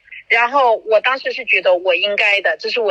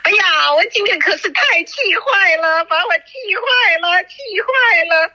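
fuming; 8 semitones higher than usual. Real, not acted. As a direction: vent the anger, pitch up and drag it out. (气呼呼地抱怨，声音高而慢)

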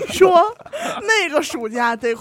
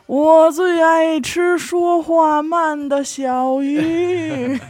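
higher, very slow drawn-out voice; slow big fish; 6 semitones higher, much slower than usual. Acted. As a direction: stretch every word out slowly. Big fish answers slowly. (拖长慢说的大鱼)

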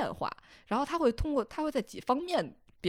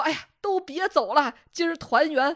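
grandma voice; 6 semitones higher, 11 dB louder than usual. Retold or imitated. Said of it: "然后外婆说".

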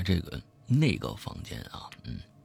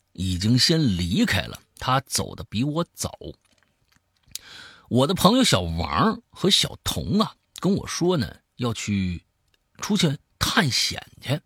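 lively storyteller swinging between hushed and emphatic; spoken horror-story narration; dramatic storytelling; 5 semitones higher, 4 dB louder than usual. A narrator's reading. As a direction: tell a creepy tale with punched key words. Tells a horror story aloud.

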